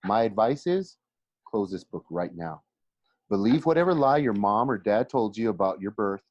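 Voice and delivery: plain reading voice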